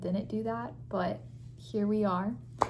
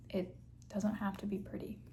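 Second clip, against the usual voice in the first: whisper; 7 dB quieter than usual. Real, not acted. Aside while applying mask.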